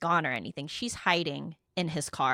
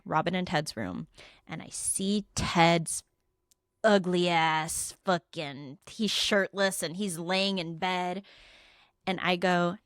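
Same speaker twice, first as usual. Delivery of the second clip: ordinary talk, nothing marked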